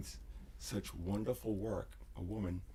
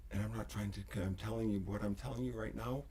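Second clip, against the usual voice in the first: normal talking voice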